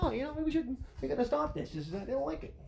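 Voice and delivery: a hammed-up change of heart.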